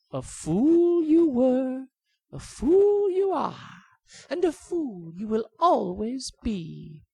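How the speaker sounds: drawn-out singsong mockery, high; bird's parting sneer; high slow bird taunt. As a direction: taunt him, stretching each phrase.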